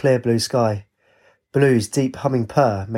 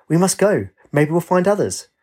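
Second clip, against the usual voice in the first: bouncy; 6 semitones higher than usual. A narrator's reading. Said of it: "Katie bounced and said".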